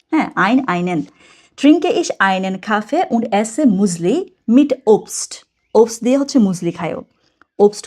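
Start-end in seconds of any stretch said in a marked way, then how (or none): 1.6-5.3 very slow, careful German reading; slow model reading. Shown as a demonstration. Teacher reads the German example slowly for learners. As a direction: read it out slowly so students follow. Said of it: "teacher reads example slowly".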